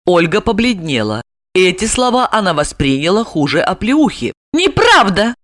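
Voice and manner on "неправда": shout. A narrator's reading. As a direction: fling the denial at him. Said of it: "закричала она".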